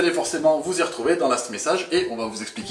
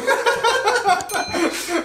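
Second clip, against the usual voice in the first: high laugh; 3 dB louder than usual. Real, not acted. Burst of laughter.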